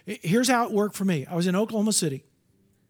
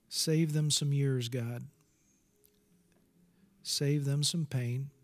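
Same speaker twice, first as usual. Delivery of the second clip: solemn prayer; 6 semitones lower, 6 dB quieter than usual. Real, not acted. Preacher asks God to give his brothers and sisters peace, solemn prayer. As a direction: plead quietly with God, phrase by phrase. Prays aloud for the congregation.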